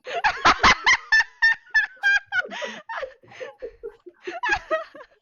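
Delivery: long shrieking laugh, near tears; hysterical laughter. Real, not acted. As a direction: cackle till you cry.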